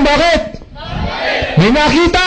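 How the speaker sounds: high, startled "Bakit?"; surprised shout. A narrator's reading. Storyteller voicing the suicidal man, startled shout. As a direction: cry out in sudden surprise.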